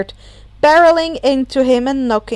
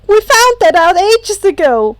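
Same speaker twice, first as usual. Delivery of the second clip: yelling; 8 semitones higher, 7 dB louder than usual. Acted. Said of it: "Kaminari yelled".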